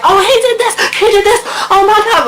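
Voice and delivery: very high, fast, laughing mock whine; whiny tattletale. Mocking how not to act in the principal's office, shrill and rushed. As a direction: play a panicked kid tattling to the principal. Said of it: "Don't be like".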